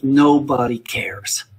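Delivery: each word slow and weighty; slow it right down so it sinks in; slow emphatic. Retold or imitated. Teacher repeating a friend's advice, slow and emphatic. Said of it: "And this is the truth".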